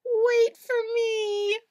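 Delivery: high, loud panicky cry; frightened cry. Acted. Scared Spike cries for Splat to wait, high panicky. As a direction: wail after your friend.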